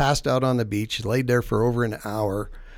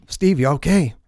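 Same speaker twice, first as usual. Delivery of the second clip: raised voice, calling to a friend; imitated concerned shout, higher and louder; retold calling out; 6 semitones higher, 6 dB louder than usual. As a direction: call across the water, moderately loud. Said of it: "Jack's still yelling at me".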